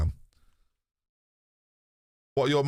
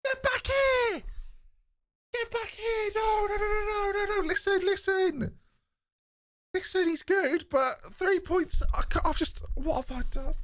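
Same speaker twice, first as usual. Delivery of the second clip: very high, strained pleading; panicked calling back. Pretend. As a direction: call him back in a desperate squeal. Calling back a departed deal in panic.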